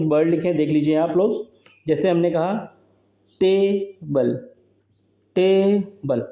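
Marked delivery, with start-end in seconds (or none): 3.4-4.5 table with a long टे, then short बल; stressed first syllable. Shown as a demonstration. Teacher showing a trochee to students, table stressed on long टे. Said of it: "इस long जो है इस, stress level है". 5.4-6.3 table with a long टे, then short बल; stressed first syllable. Shown as a demonstration. Teacher showing a trochee to students, table stressed on long टे. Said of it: "इस long जो है इस, स्ट्रेस लेवल है".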